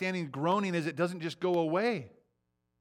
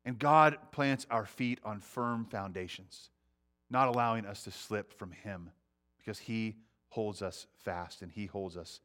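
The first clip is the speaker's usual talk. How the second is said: normal talking voice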